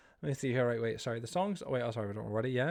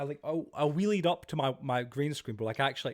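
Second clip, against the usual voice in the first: ordinary talk, nothing marked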